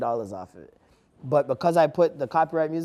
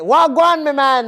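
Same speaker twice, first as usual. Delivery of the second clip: loud, high, botched patois with an Irish-pirate lilt; mock Irish-pirate patois; 10 semitones higher, 9 dB louder, slower than usual. Mocking non-Jamaicans who try patois on him, Irish-pirate accent. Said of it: "they sound like Irish pirates".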